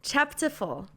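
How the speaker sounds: put-on a light British accent on the chapter title. Host announcing the next chapter to her co-host, light British accent. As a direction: slips into a hint of a British accent. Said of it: "I don't know why I started in British accent".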